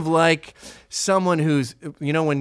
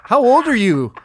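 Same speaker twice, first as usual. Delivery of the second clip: loud, higher mock-Canadian question; blunt Canadian; 6 semitones higher, 5 dB louder than usual. Imitating a Canadian asking someone's age, blunt mock-Canadian accent. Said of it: "Like, they'll go".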